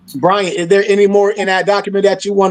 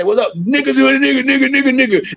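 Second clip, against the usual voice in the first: higher, sing-song mock street greeting; mocking homeboy greeting; 5 semitones higher than usual. Imitating how guys greet each other, higher mock greeting. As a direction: lightly ham up the repeated greeting. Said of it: "every son guy say".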